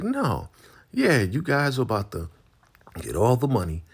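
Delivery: high accusing voice; mock accusation.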